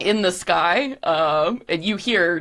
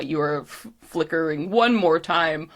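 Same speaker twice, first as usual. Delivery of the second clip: normal talking voice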